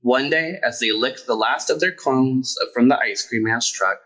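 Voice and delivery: plain reading voice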